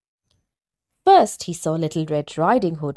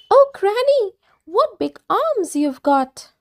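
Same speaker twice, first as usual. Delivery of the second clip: put-on much higher, wondering girlish voice; wide-eyed little girl; little girl amazed; 13 semitones higher than usual.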